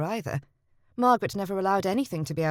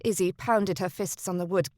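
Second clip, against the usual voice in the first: usual speaking voice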